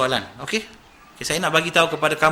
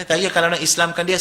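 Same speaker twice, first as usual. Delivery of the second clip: ordinary talk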